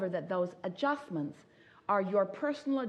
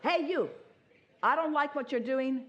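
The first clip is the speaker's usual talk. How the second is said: high mock yell with laughter; yelling back, laughing; 7 semitones higher than usual. Esther yelling back at the policeman, laughing mock yell. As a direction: shout back at him, half amused. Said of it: "Esther wanted to say".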